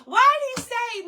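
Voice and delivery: high, loud, laughing disbelief. Real, not acted. Mom cracking up at the husband's offer. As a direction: squeal it out through laughter.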